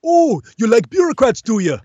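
put-on Jamaican accent, teasing; Hermes Conrad. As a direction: tease in a Jamaican lilt. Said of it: "You're Hermes Conrad".

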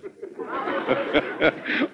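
a breathy, unvoiced burst of laughter; hearty laugh. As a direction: laugh it off, amused by the joke. President laughs at Ralph's joke.

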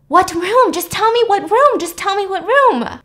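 acted-out panicked demand, high and loud; frantic freak-out. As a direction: imitate a dean frantically demanding answers. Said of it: "the dean starts freaking out".

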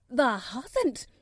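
loud, startled Yorkshire cry; a maid gasps out her disbelief, loud; startled exclamation. A narrator's reading. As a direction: blurt it out in shock. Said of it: "she exclaimed".

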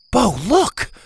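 high, excited call; urgent exclamation. A narrator's reading. Zeta points something out to Bo, excitedly. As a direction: grab a friend's attention.

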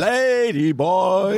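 drawn-out mock announcement; teasing interjection. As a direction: stretch it out slowly to tease. Teasing interjection naming the other guy.

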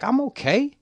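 high, loud and defensive, brushing it off; indignant denial. Retold or imitated. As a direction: act insulted that anyone would doubt you. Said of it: "I looked at him crazy 'cause I was like".

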